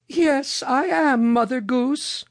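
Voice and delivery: put-on very high, slow old-tale lady voice; Mother Goose. A narrator's reading. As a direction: pitch it right up for a storybook lady.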